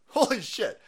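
normal talking voice

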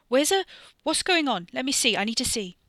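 high, loud tumbling questions; eager excitement. Acted. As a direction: rush the words, desperate to look. Wes excited, demanding mirror.

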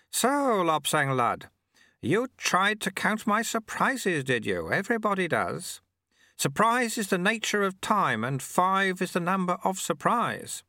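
high, leisurely voice of a playful mentor; slow, high-pitched old master; old sweeper-monk. A narrator's reading.

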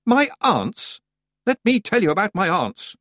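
high, indignant outburst; sudden anger. A narrator's reading. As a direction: bristle at the mention, pitch jumping up. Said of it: "suddenly angry".

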